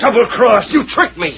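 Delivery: high, rattled cry of betrayal; panicked dismay. Acted. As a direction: caught and cornered. Beasley realizes betrayal.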